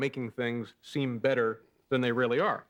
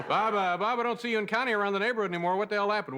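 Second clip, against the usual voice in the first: higher, quick chatty neighbor; nosy neighbor voice; 8 semitones higher than usual. Acted. Neighbor asking, acted dialogue.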